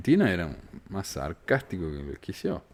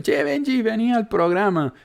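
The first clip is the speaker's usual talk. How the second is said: much higher, bright and friendly; friendly invitation; 13 semitones higher, 3 dB louder than usual. Retold or imitated. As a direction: invite Benji warmly. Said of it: "No le voy a decir". (invitación amistosa y muy aguda)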